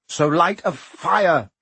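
choked. A narrator's reading. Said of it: "Harry choked".

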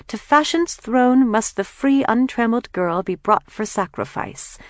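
high, mock-grand declamation; sarcastic yet enthralled. A narrator's reading. Kathleen reads fashion prose aloud, sarcastic and enthralled. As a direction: declaim it grandly, half mocking, half rapt. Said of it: "at once sarcastic and enthralled".

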